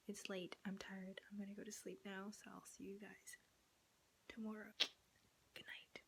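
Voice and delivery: whispering so the parents can sleep; hushed late-night whisper; quiet at night. Real, not acted. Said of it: "I have to talk quiet 'cause obviously my parents are sleeping".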